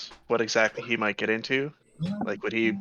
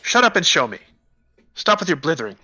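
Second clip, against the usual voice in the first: louder, higher angry order; acted irritated outburst; impatient command; 5 semitones higher, 5 dB louder than usual. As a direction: cut off the blithering with a sharp order. Acted impatient threat to gnome.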